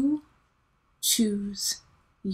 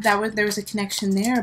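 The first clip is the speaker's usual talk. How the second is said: ordinary delivery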